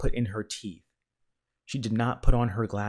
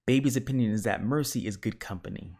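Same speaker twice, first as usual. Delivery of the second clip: plain reading voice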